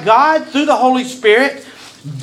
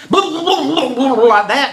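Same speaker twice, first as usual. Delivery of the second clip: mock splutter; 5 semitones higher than usual. Mimics someone struggling underwater.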